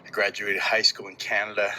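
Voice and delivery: ordinary delivery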